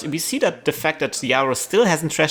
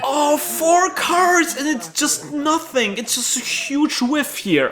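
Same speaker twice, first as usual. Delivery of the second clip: much higher, drawn-out excited call; excited play-by-play; 4 dB louder, slower than usual. Real, not acted. Commentator calling the big run reveal, excited. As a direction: ride the tension of the reveal.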